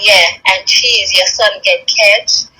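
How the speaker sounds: drawn-out, taunting announcement; slow, sing-song taunt; slow mocking quote. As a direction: stretch each word out to mock her. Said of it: "and say".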